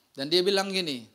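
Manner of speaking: normal talking voice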